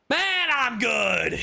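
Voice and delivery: very high, slow, squeaky voice; drawn-out falsetto joke reply; mock squeaky boast. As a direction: stretch it out like a cartoon brag. Streamer reacts to cutscene praise.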